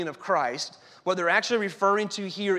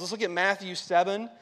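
usual speaking voice

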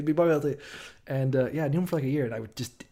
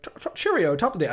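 halting, higher-pitched attempt at British; bad British accent; 7 semitones higher, faster than usual. Imitating very British coworker, badly faked British accent. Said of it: "I can't do it".

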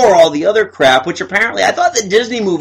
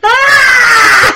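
high shout of alarm; drowning scream; 6 dB louder than usual. Retold or imitated. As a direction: yell like a man in the water. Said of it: "Geppetto, like, in the water".

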